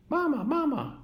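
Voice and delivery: put-on very high, tiny baby bird voice; tiny gosling peep. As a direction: chirp it like a lost chick. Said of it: "peeped the gosling".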